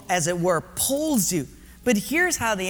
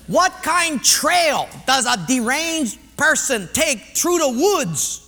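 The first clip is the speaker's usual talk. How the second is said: put-on raised, local-accented quiz boss; personnel guy, insistent; 5 semitones higher, 5 dB louder than usual. Personnel guy puts the next question, loud and insistent. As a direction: pose it loud and high, like a pidgin quizmaster.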